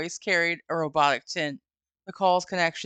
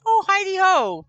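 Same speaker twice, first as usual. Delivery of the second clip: much higher, louder singsong greeting; overly cheerful; 14 semitones higher, 8 dB louder than usual. Retold or imitated. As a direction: chirp it like a delighted host. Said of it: "like, happy about it".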